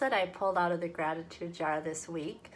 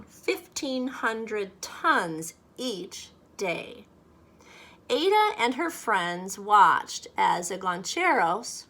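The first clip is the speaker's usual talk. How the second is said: lively, louder storybook voice; story reading to children; 5 dB louder than usual. A narrator's reading. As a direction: read it aloud like a picture book for kids. Reads picture book aloud to children.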